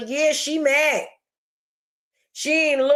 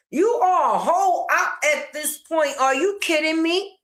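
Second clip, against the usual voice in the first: ordinary talk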